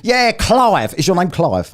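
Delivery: high, mocking Australian bloke voice; mock Australian accent. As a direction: send up an Aussie in a high put-on twang. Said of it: "talk Australian to a bloke from the U K".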